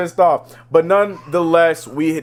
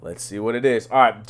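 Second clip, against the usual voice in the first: normal talking voice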